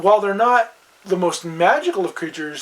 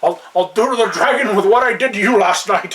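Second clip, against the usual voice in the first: louder, higher put-on Scottish growl; mock Sean Connery; 5 semitones higher, 6 dB louder than usual. Joking about movie dwarves, in mock Scottish accent. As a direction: ham up a Connery-style brogue.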